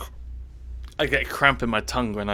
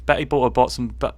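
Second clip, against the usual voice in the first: usual speaking voice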